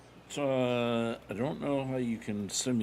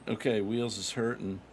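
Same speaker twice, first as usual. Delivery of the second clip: ordinary delivery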